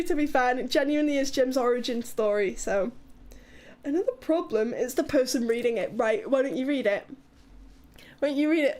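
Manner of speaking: ordinary delivery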